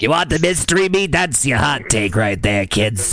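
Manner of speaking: lightly voiced creepy lunch lady; creepy cafeteria lady. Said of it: "creepy cafeteria lady".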